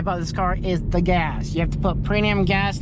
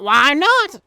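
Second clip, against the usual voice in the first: excited; 6 semitones higher, 6 dB louder than usual. Real, not acted. Playful rhetorical answer.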